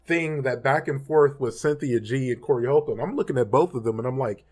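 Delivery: ordinary talk, nothing marked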